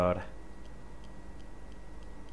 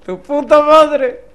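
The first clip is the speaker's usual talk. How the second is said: high, drawn-out furious yell; furious shout; 14 dB louder, much slower than usual. Real, not acted. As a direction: scream it out slowly at the game. Puzzle fails, he rages.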